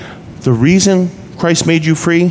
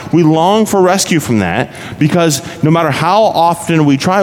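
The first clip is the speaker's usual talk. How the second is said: normal talking voice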